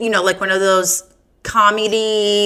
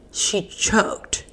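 whisper; 5 dB quieter than usual. Acted. Punchline said breathily.

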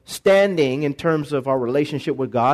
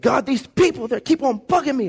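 higher-pitched mock whine of a fed-up leader; a hammed-up, fed-up grumble; mock exasperated complaint; 6 semitones higher than usual. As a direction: complain to God about the people like you've had enough. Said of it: "was mad at the people".

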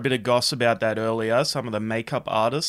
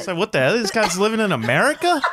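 high, loud mock indignation; mock outrage; 10 semitones higher, 4 dB louder than usual. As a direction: play it outraged, laughing.

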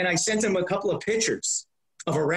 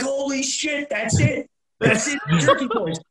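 higher, louder, half-laughing cry of discovery; excited outburst; 8 semitones higher, 4 dB louder than usual. Retold or imitated. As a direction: burst out like you just found the answer. Guys on phone hearing name.

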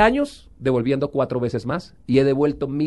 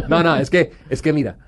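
normal talking voice

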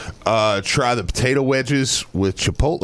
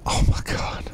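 whispered; 3 dB quieter than usual. Real, not acted. Savoring the food memory.

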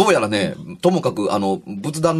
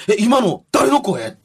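startled; 11 semitones higher, slower than usual. Retold or imitated. Startled mother asks.

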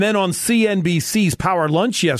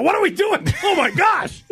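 high-pitched yell of comic shock; hammed-up horrified outburst; mock outraged shout; 13 semitones higher than usual. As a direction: shout it in exaggerated disbelief at the bill. Retelling his shock at bill.

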